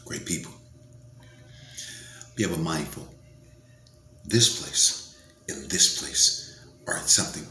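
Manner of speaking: ordinary talk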